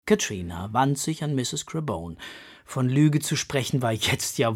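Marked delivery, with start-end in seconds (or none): none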